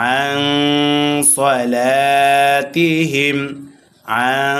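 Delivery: nasal hum held on the "ন" of আন; slow আন সলাতিহিম, nasalised join into স; ikhfa ghunnah. Shown as a demonstration. Teacher showing ikhfa, long nasal আন before সলাতিহিম. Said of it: "গুন্নার সহিত মিলিয়ে পড়তে হবে".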